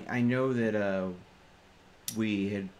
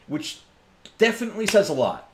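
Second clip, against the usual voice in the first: loud boast; 9 semitones higher, 9 dB louder than usual. Real, not acted. Bragging about haggling.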